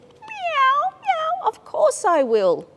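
high, mewing cat voice; playful cat voice. A narrator's reading. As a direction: meow like a little cat. Cat answers in story.

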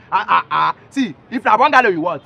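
ordinary talk, nothing marked

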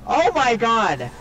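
slow loud startled oh my god; shocked. Real, not acted. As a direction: caught off guard, loud. Sudden scare in game.

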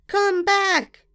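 put-on girl screams for her doll to return. A narrator's reading. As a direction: shout after the flying doll. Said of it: "I screamed".

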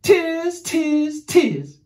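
loud monkey cries, high then dropping; high, loud storybook monkey chant; chattering monkeys. A narrator's reading. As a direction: mimic cheeky monkeys jeering back. Monkeys mimic the scolding peddler.

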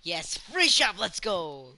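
loud, high whoop of joy; excited shout. Real, not acted. Gamer cheering the free shop, excited shout. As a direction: cheer like you just got a freebie.

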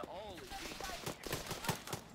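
stunned gasp. Real, not acted. Sees explosion.

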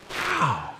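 ordinary talk, nothing marked

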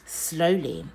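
ordinary delivery, nothing marked

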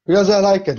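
defiant retort. Retold or imitated. Speaker answering imagined critics, defiant. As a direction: fire the answer back at the critics.

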